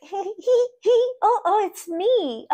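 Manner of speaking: very high, tiny giggly imitation; cute giggling. Imitating her client discovering herself, cute giggling voice. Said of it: "giggling. So cute".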